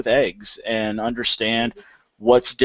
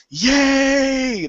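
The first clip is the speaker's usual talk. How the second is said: high, drawn-out mock cheer; excited kids' cheer. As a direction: imitate children cheering at dinner, pitched way up. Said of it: "They're all excited".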